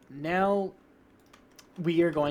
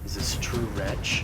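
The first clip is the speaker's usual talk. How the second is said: orc leader; 7 semitones lower than usual. Acted. Orc leader to captured wretch, low menacing role voice. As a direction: lower orc boss questioning a captive.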